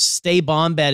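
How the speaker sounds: usual speaking voice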